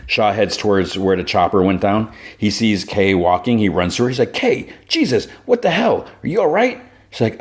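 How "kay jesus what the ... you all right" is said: high, rushed worry at the crash site; a man finding his colleague alive after a crash; alarmed relief. Retold or imitated. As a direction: pitch up with shocked concern. Shaw runs to Kay after crash.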